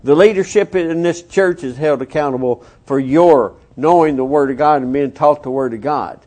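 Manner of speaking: usual speaking voice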